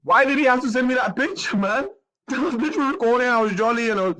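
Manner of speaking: high-pitched tearful wail; crying hard, voice way up; real crying. Real, not acted. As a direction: protest through sobs with a laugh breaking in. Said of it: "me crying".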